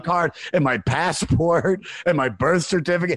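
high and loud, piling it on in distress; rising panic. Retold or imitated. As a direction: list it all in a frantic rush. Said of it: "And she goes".

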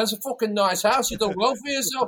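high-pitched mock rocker flattery. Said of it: "such a good fucking impersonation of them".

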